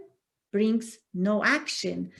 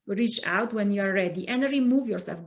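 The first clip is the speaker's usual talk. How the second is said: ordinary delivery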